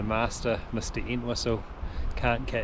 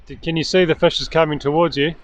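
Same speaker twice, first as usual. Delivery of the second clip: raised, higher voice to carry to the angler; loud, higher-pitched question called to a fishing buddy; calling out; 5 semitones higher, 7 dB louder than usual. Real, not acted. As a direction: call across the water. Calling to angler across the river.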